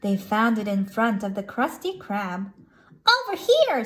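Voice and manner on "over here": high, carrying cartoon call. SpongeBob waves for the creature's attention, calling out. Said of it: "SpongeBob called".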